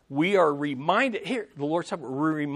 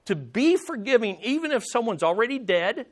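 ordinary talk, nothing marked

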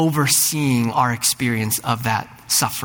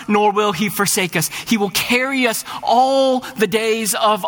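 much higher, ringing pulpit appeal; climactic preaching; 8 semitones higher than usual. Acted. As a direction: lift the voice and plead with the room.

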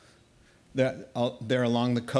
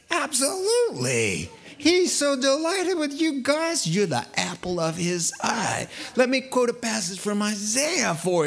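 high, gushing flattery, building to a near-sob; fawning priest; 7 semitones higher than usual. Retold or imitated. Priest flattering the Danites, high gushing mock sincerity. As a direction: gush over them like a salesman priest.

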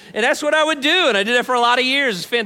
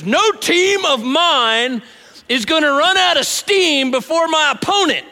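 Coach Norman Dale; 6 semitones higher, 5 dB louder than usual. Retold or imitated. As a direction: bellow at exhausted players like a drill coach. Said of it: "he goes".

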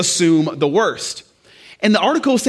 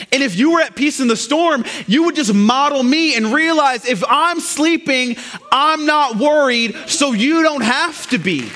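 higher, emphatic preaching in Jesus' words; frustrated Jesus; 7 semitones higher than usual. Retold or imitated. Jesus teaching the disciples peace in the storm, frustrated and emphatic. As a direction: drive the lesson home, pitch held high. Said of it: "The reason Jesus was frustrated".

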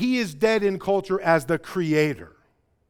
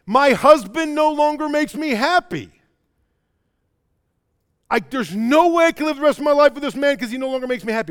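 mock grievance, pitched up and louder; imitated complaint; 7 semitones higher, 4 dB louder than usual. Wife telling her pastor about her husband, mock complaining. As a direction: act out an unhappy wife complaining. Acted complaint of unhappy spouse.

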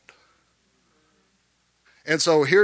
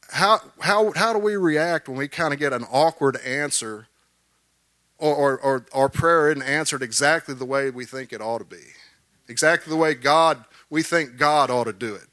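ordinary talk, nothing marked

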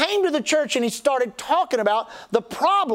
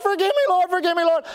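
mock crying out; 6 semitones higher than usual. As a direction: cry out to the Lord in half-serious imitation. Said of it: "crying out".

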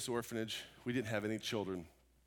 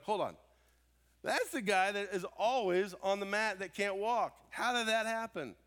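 higher and louder disbelief; astonished neighbours; 9 semitones higher, 4 dB louder than usual. Retold or imitated. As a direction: unable to believe he is walking. Neighbours see him walking.

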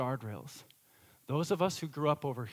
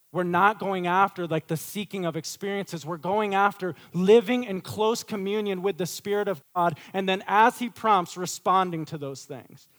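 emphatic preaching; 5 semitones higher, 8 dB louder than usual. Acted.

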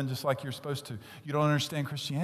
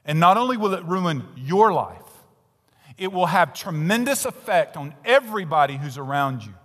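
loud, rising preacher's warning; preaching at full force; 5 semitones higher, 9 dB louder than usual. Acted. Preacher warning the congregation about sin, loud sermon. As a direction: thunder the warning from the pulpit.

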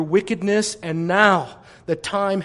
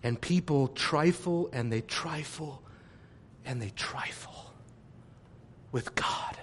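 fading from soft to a whisper; hushed whisper; 6 semitones lower, 14 dB quieter than usual. Acted. Preacher to congregation, warning of trifling with God, fading whisper. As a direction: let it die away to a whisper.

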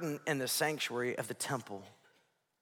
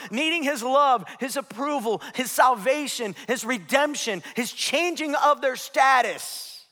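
loud, high, driving sermon build; preaching at full force; 10 semitones higher, 11 dB louder than usual. Acted. Pastor preaching full force to the congregation. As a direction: preach it hard to the whole room.

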